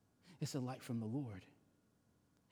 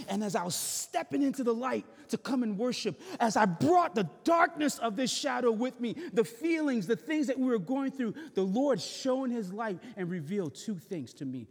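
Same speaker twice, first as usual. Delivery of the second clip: much higher and louder, preaching climax; full-force preaching; 8 semitones higher, 7 dB louder than usual. Acted. Pastor telling congregation his testimony, loud preaching climax. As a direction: ring it out to the back row.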